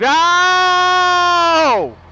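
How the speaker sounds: high, loud startled oh; a sudden shocked cry; surprised exclamation. Real, not acted. Startled gamer finds the farm entrance moved, loud high cry.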